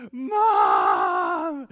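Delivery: high, loud wailing call; toddler cry. Retold or imitated. Scared daughter crying out for mom.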